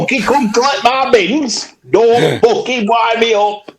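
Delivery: put-on high, strained old Yorkshire rant; old Yorkshire man. Ordering the bin collectors about as an old Yorkshire man. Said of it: "he's an old Yorkshire man now".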